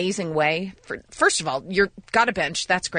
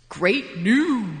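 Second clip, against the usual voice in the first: drawn-out sign-off; 2 semitones higher than usual. Real, not acted. Names the feature with flourish.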